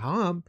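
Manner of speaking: imitated British vowel in "ham"; British pronunciation. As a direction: say "ham" with an open "ah", like "hahm". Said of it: "people from England".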